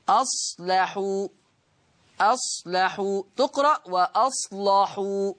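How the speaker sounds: slow أصلحوا with a thick, heavy لام; teacher demonstrates the heavy lam in أصلحوا. Shown as a demonstration. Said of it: "شايفين اللام مغلظة إزاي".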